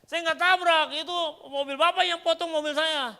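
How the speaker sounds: loud, high protest; high-pitched indignant retort; acted angry denial. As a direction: argue back at full volume. Acts out driver arguing back.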